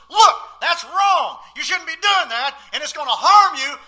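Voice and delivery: put-on loud, high warning to a child; stern parent warning.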